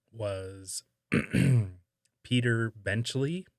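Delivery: ordinary talk, nothing marked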